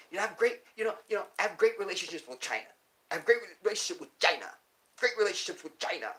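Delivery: mock a boastful president on repeat; hammed-up boastful leader voice; Trump impression. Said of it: "Who the fuck talks like this".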